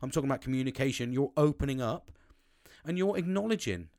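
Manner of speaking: ordinary talk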